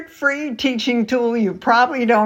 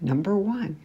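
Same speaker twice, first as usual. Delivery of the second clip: ordinary talk, nothing marked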